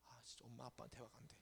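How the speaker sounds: acted teen's resigned mutter; muttered complaint. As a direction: grumble it under your breath, giving up. Voices frustrated teen giving up.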